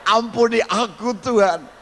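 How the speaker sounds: slow, high, pleading plea; penitent prayer. Acted. Preacher cries out to God for forgiveness. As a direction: beg God for mercy.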